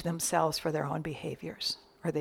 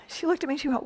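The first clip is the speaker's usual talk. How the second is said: usual speaking voice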